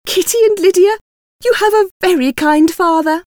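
high-pitched, gushing delight; overjoyed. A narrator's reading. Mother telling daughters their father visited, overjoyed. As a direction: exclaim with joy in a soaring voice. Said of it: "Missus Bennet was overjoyed".

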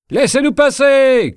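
shouted high and loud, a crowd demanding entry. A narrator's reading. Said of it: "criait le peuple". (cri de la foule, beaucoup plus aigu et plus fort)

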